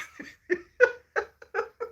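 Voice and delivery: high, helpless laughter; real high-pitched giggling fit; laughing. Real, not acted. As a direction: crack up at your own impression. Laughs at own impression.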